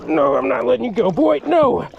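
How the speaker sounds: holding on for dear life, voice climbing; straining excited yell. Real, not acted. Angler fighting a huge catfish on the kayak, strained excited yell. Wrestling catfish, refusing to let go.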